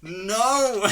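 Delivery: high, loud pretend scream of no; hammed-up villager cry; mock panic cry.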